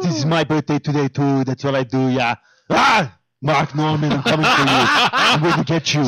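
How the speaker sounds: put-on Austrian accent; Arnold Schwarzenegger. Action star threatening a rival, mock Austrian accent. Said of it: "I had an Arnold Schwarzenegger impersonation".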